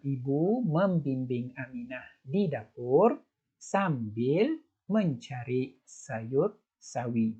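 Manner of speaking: slow reading with proper sentence intonation; correct intonation. Shown as a demonstration. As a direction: show the right rise and fall, slowly. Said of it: "macam tu intonasi yang betul".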